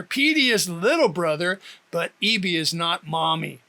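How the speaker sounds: ordinary delivery, nothing marked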